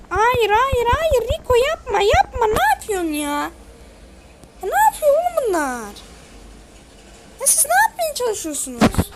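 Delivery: loud, high rapid pleas of no; real gamer panic, begging no; panicked shouting. Real, not acted. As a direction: yell to stop a teammate's mistake. Pleading as teammate blunders.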